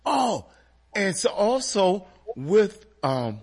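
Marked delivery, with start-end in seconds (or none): none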